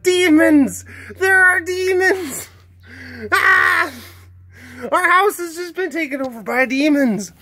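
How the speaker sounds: high, frantic cat voice yelling about demons; cat Richard Parker screaming about demons, voiced high; panicked pet voice.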